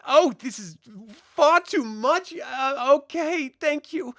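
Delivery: high-pitched, taken-aback barmaid voice; flustered barmaid. Acted. Barmaid is handed far too much gold, flustered. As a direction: accept it in a high, hesitant flutter.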